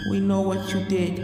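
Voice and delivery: soft, eerie insistent voice; insistent ghost. Acted. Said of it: "she insisted".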